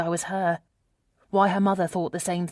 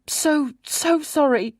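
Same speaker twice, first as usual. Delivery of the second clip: high, tearful apology; acted voice cracking with remorse; voice breaking; 8 semitones higher, 4 dB louder, slower than usual. A narrator's reading. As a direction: let the voice break on a heartfelt apology. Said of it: "My voice breaks on the last words".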